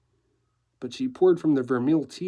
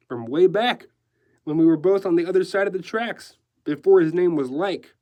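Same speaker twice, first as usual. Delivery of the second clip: higher, louder, animated decorator; breezy decorator; 5 semitones higher, 6 dB louder than usual. Acted. Decorator recalling an old friend, breezy. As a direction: push it out with zest. Decorator's lines in narrated story.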